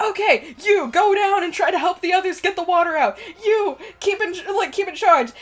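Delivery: high, urgent command voice; captain giving orders. Acted. Captain organizing crew on sinking ship, urgent orders. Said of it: "he's like".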